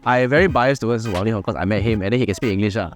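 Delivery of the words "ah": ordinary talk, nothing marked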